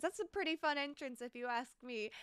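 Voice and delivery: ordinary talk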